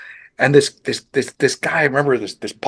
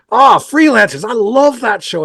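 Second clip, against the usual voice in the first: high, loud mock Massachusetts accent, enthusing; 10 semitones higher, 7 dB louder than usual. George praising the Freelancers show, Massachusetts accent. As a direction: gush about the show in a Boston accent. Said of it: "I can't do the Massachusetts accent".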